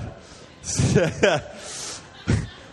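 a quick breathy laugh at himself. Real, not acted. Laughing at his own mug.

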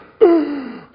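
loud, high mock gasp; freaked-out gasp. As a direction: gasp in hammed-up shock. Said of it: "I'd freak out".

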